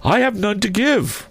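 very high, wailing phantom voice; mournful ghost. A narrator's reading. Ghost refusing comfort to his old partner, mournful high voice. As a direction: intone it high as a restless spirit. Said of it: "the ghost replied".

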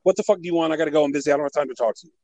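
imitating a hurried, brusque manager; fast rambling. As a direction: rattle it off with no time to spare. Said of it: "It was just fast rambling off".

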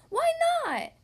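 very high, loud outburst; shouted question. A narrator's reading. Bella hears a guest is skipping her party, high shout. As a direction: shriek it in indignant disbelief. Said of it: "Bella shouted".

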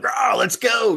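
a high, pumped-up rah; rally the guys like a locker-room cheer; mock battle cry, pitched well up; fired-up cheer. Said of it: "They're just like".

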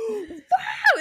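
high, teary mock cry of wow; imitating a sobbing mom's wow; weepy gasp of awe. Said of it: "she goes".

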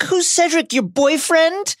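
high, slow jeering; mocking. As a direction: tease him mockingly. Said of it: "Dudley recounts this for him".

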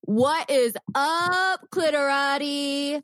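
loud, high, stretched-out hail; hyped show opening. Real, not acted. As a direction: belt out a slow, rousing podcast greeting.

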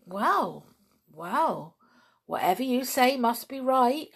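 animated reluctant agreement; imagined chimpanzee voice. A narrator's reading. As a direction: concede to the boss in lively character.

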